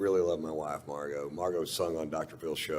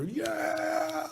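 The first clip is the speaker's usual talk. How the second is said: high, drawn-out cheer; long high whoop; imitated crowd cheer. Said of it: "people stand up ... and start cheering".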